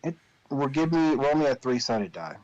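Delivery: ordinary talk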